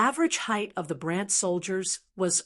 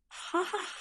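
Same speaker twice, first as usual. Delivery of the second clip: light high laugh; 11 semitones higher, 7 dB quieter than usual. A narrator's reading. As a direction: laugh it off kindly. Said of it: "Andre couldn't help but laugh".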